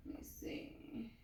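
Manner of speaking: soft working whisper. Real, not acted. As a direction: say it under the breath. Muttering while working.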